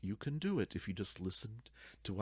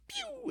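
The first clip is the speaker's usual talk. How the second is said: swoop sound effect; 4 dB quieter than usual. Acted.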